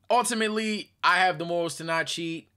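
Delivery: ordinary talk, nothing marked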